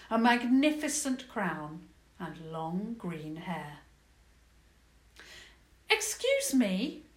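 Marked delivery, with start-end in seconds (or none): 5.9-7.1 high, loud, slow call across a hall. Acted. Princess calls to the goblin hall, loud polite call. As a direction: call out politely to get attention. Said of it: "Princess Isabel called".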